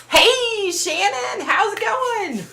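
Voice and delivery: high sing-song hello; cheery greeting. Real, not acted. Streamer welcoming a viewer into the chat, cheery sing-song.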